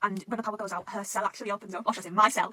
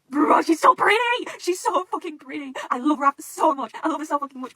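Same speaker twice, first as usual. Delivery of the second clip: loud, high, drawn-out squeal of adoration; real fangirl gushing; 7 semitones higher, 12 dB louder, slower than usual. Real, not acted. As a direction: gush slowly with stretched-out words. Gushing over Raph's appearance.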